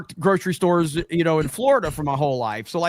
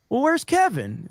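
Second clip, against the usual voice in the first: higher, casual imitated question; Jay; 9 semitones higher than usual. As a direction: ask it breezily, pitched well up. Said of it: "but then Jay goes".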